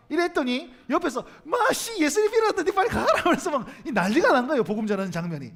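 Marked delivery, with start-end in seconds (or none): 1.4-3.2 high, loud, laughing taunt; mocking teen jeer. Teen mocks the preacher telling him to go, laughing loudly. Teens mocking the street evangelist.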